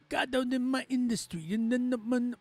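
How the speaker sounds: imitating the rapper's Chicano accent; light Chicano accent on the quoted bars; mock Chicano accent. Streamer imitating a rapper's Chicano accent, lightly. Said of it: "a Chicano accent. You can hear it".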